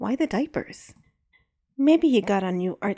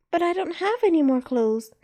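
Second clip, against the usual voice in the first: put-on whiny little girl; 9 semitones higher than usual. Kid complains.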